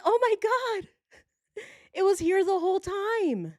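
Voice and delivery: high squealing mock amazement; retold surprise. Owner discovering the phone on the couch, high amazed squeal. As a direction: squeal it like you just found it. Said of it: "And I'm like".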